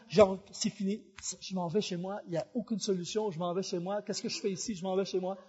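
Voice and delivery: low, soft defeated mutter; muttering to himself. Retold or imitated. Stuck driver talking himself into going home, muttering. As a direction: grumble it under your breath, low and soft. Muttering his thoughts in the car.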